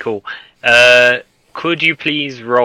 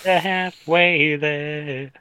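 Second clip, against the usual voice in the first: slow; 4 semitones higher, 6 dB quieter, much slower than usual. Retold or imitated. Player echoes GM mockingly.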